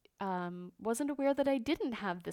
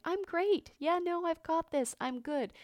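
mock upbeat brush-off; 9 semitones higher, 6 dB louder than usual. Mocks the brush-off she avoids.

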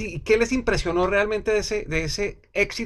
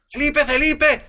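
calling out; 6 semitones higher, slower than usual. Retold or imitated. Calling from car window.

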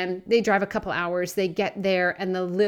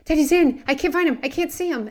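high, loud panicked calling out for dad; retold panicked yell, higher and louder; 7 semitones higher, 7 dB louder than usual. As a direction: yell it like a frightened teen shouting across the dock. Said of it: "the dad hears the older boy yelling".

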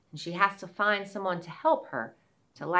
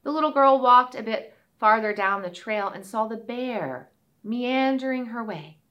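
ordinary delivery

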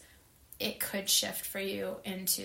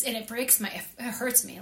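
ordinary talk

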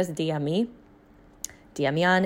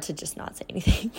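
hushed confession, voice gone to breath; whisper; 5 dB quieter, faster than usual. Real, not acted. Admitting to listeners she stayed silent, whispered. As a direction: whisper it like a guilty secret.

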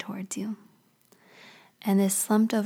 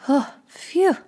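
acted sigh of relief, pitched up; relieved phew; 8 semitones higher, 3 dB louder than usual. Fear to you after reaching the grass, relieved. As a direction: puff out a big mock "phew".

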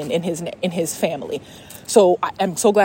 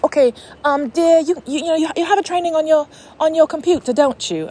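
a high, shaky attempt at a British accent; failed British accent, pitched high; 9 semitones higher, 3 dB louder than usual. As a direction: try a British accent for the psychic and miss. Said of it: "I, I can't do British, I'm sorry".

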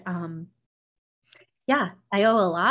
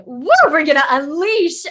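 higher, louder gleeful cheer; excited whoop; 8 semitones higher, 11 dB louder than usual. Retold or imitated. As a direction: whoop it like you're letting go. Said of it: "I was just just like".

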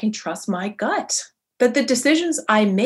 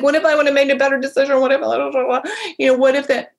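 rushed, loud, higher-pitched second-guessing; mock fretting; 6 semitones higher, 5 dB louder, much faster than usual. Coach voices people regretting their choices, mock fretting. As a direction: imitate someone spiraling into what-ifs, fast and high.

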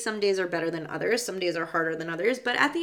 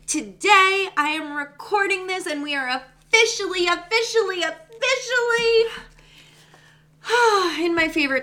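each officially higher and louder, rising giddy excitement; building excitement; 11 semitones higher, 5 dB louder than usual. Real, not acted. Podcast host announcing Pisces season, climbing excitement. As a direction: let the thrill climb with every officially.

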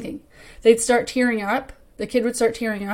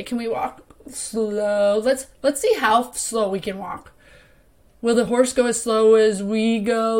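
slowed-down, drawn-out tired voice, playing at being sleepy; tired slow mentor. Mentor to kid, a lightly played tired slow voice. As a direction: make walking slow a game, drag every word. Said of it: "Have a".

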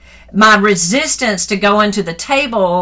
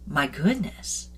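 slow, softer, awed; awed gasp; 7 dB quieter, slower than usual. Retold or imitated. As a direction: breathe it out in quiet amazement. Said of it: "I'm just like".

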